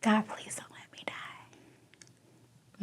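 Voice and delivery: slow, choked whisper reliving a plea; tearful whispered prayer. Retold or imitated. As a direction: whisper it slowly, close to tears. Tearfully relives praying before surgery.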